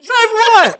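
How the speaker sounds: high, loud incredulous "what". Real, not acted. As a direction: shoot up in disbelief. Incredulous echo.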